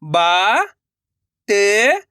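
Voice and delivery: बा, त each held long and rising; letter-by-letter demo. Shown as a demonstration. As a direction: sound out बा and त separately. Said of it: "एक अक्षर का उच्चारण सही से". (बा, त अलग-अलग खींचकर)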